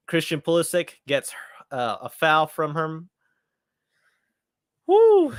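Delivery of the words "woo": loud, very high "woo"; thrilled fan's whoop; excited whoop. Real, not acted. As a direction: let out a sudden high whoop. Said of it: "Sorry if I'm getting a little excited".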